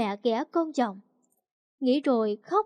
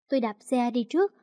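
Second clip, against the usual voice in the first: ordinary delivery, nothing marked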